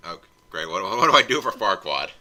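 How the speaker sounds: normal talking voice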